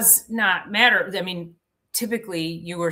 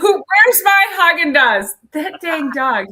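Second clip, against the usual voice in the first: high, loud, hammed-up indignation; joking about the dog stealing her Haagen-Dazs, loud mock outrage; 9 semitones higher, 10 dB louder than usual. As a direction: pretend the dog stole your ice cream.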